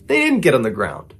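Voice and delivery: usual speaking voice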